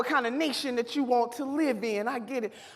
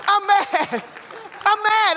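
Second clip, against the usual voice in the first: loud, higher angry declaration; mad; 7 semitones higher, 5 dB louder, slower than usual. Real, not acted. Owning her anger before the convention, loud declaration. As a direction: hammer out the anger, louder and higher. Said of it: "I'm angry".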